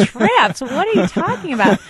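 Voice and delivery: high-pitched incredulous question with a laugh; retold disbelief. Wife retelling her shock at husband's quiz answer, incredulous. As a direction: laugh in amused disbelief as you ask.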